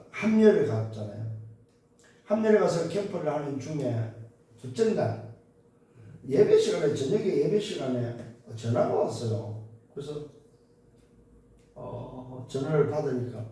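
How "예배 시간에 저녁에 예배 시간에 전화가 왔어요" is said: normal talking voice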